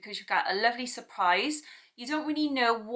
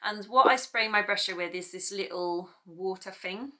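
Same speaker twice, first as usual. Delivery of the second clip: normal talking voice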